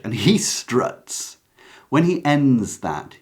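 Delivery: ordinary talk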